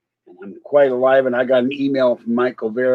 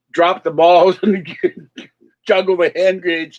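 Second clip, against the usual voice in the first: higher, words broken up by real laughter; laughing; 4 semitones higher than usual. Real, not acted. Vlogger describing a circus juggler with grenades, laughing. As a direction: chuckle through the absurd picture. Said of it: "I keep laughing because".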